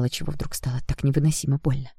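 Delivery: usual speaking voice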